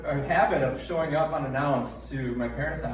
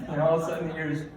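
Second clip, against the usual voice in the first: ordinary talk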